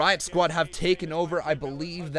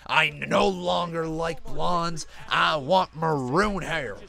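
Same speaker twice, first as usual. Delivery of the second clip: slow, hammed-up boss voice, louder; Vince McMahon; 3 semitones higher, 5 dB louder, slower than usual. Streamer mimicking boss's change of mind about hair, hammed-up impression. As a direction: do a bad impression of a wrestling boss, drawn out. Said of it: "worst Vince McMahon accent".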